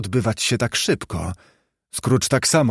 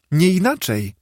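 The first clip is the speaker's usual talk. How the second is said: higher-pitched happy confirmation; beaming little girl's eager yes; radiant agreement; 7 semitones higher than usual. A narrator's reading. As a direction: affirm it gleefully. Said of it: "potakiwała dziewczynka rozpromieniona".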